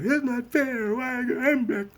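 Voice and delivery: put-on very high little-kid voice; child's protest. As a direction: whine it like a four-year-old.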